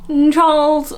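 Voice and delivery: put-on high, slow, languid upper-class English accent; bored posh RP. Bored posh person asking Charles for tea, exaggerated RP accent. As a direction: drawl it in bored, hammed-up RP. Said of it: "they just seem really bored".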